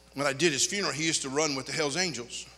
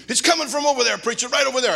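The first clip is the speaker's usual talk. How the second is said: higher, louder urgent call; urgent pointing; 8 semitones higher, 6 dB louder than usual. Retold or imitated. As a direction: point out where the shots come from. Pointing out the shooter.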